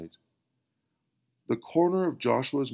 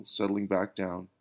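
usual speaking voice

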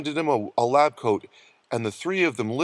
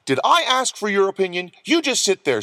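higher, bossy scolding; sharp rebuke; 7 semitones higher, 4 dB louder than usual. A narrator's reading. Nurse silences Butch's protest, scolding. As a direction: shut him down firmly.

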